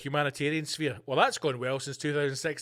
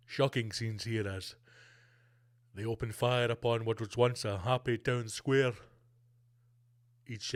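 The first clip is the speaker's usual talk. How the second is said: news reporter voice; 6 semitones lower than usual. Pretend. Reporter covering tanks in Kyiv, low and grave. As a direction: report it like a solemn field reporter. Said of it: "You know, world media there".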